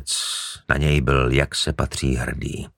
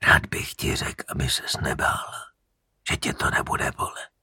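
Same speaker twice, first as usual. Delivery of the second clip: softer, breathless killer's whisper; menacing whisper; 6 dB quieter than usual. Acted. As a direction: whisper false comfort to the victim. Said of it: "Konečně promluvil".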